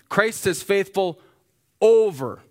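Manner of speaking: "over" punched loud and high; stress on over. Shown as a demonstration. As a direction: hit "over" hard. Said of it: "In, over, significant".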